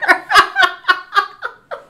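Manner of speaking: high, loud cackling laugh; real shrieking laughter; helpless laughter. Real, not acted. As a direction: lose it laughing. Cracking up at her story.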